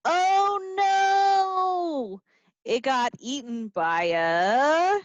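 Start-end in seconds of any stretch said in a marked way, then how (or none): 0.1-2.2 much higher mock "oh no"; mock alarm. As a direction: gasp it out for kids. Song alarm.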